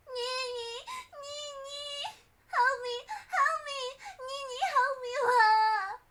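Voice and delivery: high, tearful calls for Nini; a child sobbing for help; wailing, crying child; wailing girl. Acted. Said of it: "continued to wail".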